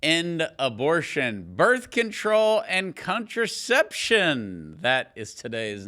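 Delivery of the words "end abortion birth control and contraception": upbeat host opener; bright announcer-style welcome; podcast intro. Acted.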